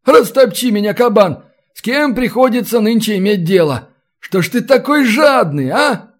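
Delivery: high, animated scolding of a miser; exasperated haggler. Acted. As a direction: grumble theatrically at a greedy customer.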